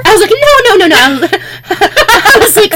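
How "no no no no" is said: loud, high burst of no's; rapid-fire alarmed objection, pitched way up; retold protest. Coworker objecting to chemicals near swimmers, retold loud protest. Retells own panicked objection.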